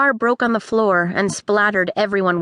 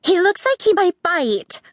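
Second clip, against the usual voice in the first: put-on higher worried girl voice; girl crying out; 9 semitones higher than usual. A narrator's reading. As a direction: warn with alarm. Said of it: "a girl cried".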